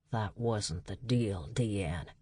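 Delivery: calm menace held down; quiet warning. A narrator's reading. Dutch warning Deanne, quiet and slow.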